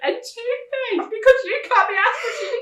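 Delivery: high, loud, slow laughing rant; laughing mock scold. Mother retelling her rant about wasted money. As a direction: scold while cracking up. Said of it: "scolding son, retold".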